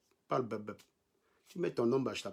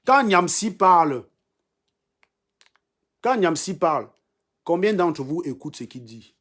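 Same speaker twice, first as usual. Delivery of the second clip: emphatic challenge; 3 semitones higher, 6 dB louder than usual. Real, not acted. Streamer challenges his live viewers about Niamsi, loud and emphatic. Angry rant at live commenters.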